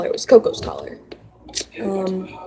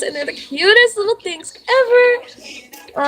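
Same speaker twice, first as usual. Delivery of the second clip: gushing; 12 semitones higher, slower than usual. Real, not acted. Gushing about cute kittens.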